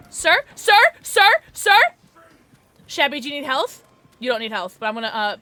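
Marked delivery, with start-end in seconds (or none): 0.1-1.8 high, loud, repeated questioning call; insistent calling. Real, not acted. Player trying to get a sir's attention, loud insistent calls. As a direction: pester louder with each repeat, pitched up. Pestering someone for attention, building.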